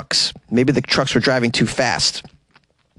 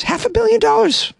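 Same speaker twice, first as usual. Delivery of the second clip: incredulous. Real, not acted. Repeating the sum in disbelief.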